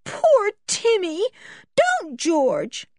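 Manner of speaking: high, slow, pitying plea; upset, high-pitched pleading; almost in tears. A narrator's reading. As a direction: beg tearfully on the dog's behalf. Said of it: "almost in tears".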